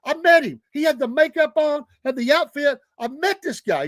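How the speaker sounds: high-pitched, imitated drunken insistence; insistent drunk friend. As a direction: play a tipsy friend swearing it's true. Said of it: "he's three sheets to the wind".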